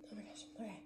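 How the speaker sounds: unvoiced oh my gosh, to herself; whispered okay; whispered aside. Real, not acted. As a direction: breathe it out, overwhelmed. Overwhelmed aside to self.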